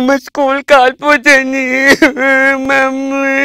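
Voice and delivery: slow, high, drawn-out mock wail; crying child. Small boy crying to mummy about his school, wailing. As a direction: bawl like a little kid wanting mummy. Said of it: "అని ఏడుస్తున్నాడు".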